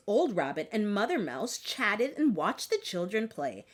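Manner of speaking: plain reading voice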